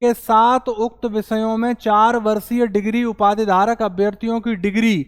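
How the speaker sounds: ordinary delivery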